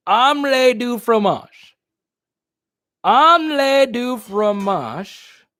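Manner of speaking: "Omelette du fromage" slow and high, with big cartoon confidence; slow, overconfident fake French; confident mock French. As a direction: proudly sound out "Omelette du fromage" like the cartoon kid. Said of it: "The confidence was there".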